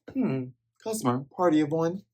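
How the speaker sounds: a hint of a gruff cook; mock Patty voice. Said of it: "Patty asks".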